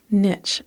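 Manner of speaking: "niche" said firmly as NITCH. Shown as a demonstration. Narrator going back to her own version, "niche" firmly as NITCH. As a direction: say "niche" to rhyme with pitch. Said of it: "I say niche".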